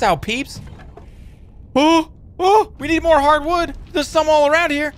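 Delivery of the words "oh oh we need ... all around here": high-pitched gleeful exclaiming; excited discovery. Real, not acted. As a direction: get carried away by a lucky find. Spots needed resources in game.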